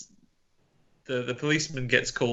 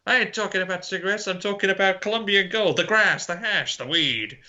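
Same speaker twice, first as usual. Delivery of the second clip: hammed-up policeman lecturing about drugs; mock eighties cop; tough cop; 8 semitones higher than usual. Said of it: "he's like".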